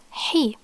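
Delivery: "he" as an Arabic h with an ee vowel; demonstrated pronunciation. Shown as a demonstration. Teacher shows how kasra makes it "he", demonstrated pronunciation. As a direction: sound out "he" as one isolated syllable. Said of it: "this will be pronounced as".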